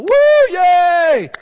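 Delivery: big high whooping cheer; cheering crowd. Retold or imitated. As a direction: shout it like a jubilant crowd.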